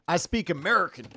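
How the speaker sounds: mock-proud, punched-up delivery; joking tone. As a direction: proclaim it loudly as an obvious joke. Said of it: "it's usually in this tone".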